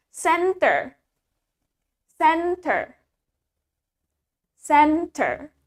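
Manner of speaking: CENTER said "sen-ter", deliberately split; teacher's careful CENTER, same for both spellings; model pronunciation. Shown as a demonstration. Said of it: "caranya ngucapin itu adalah, um, sama".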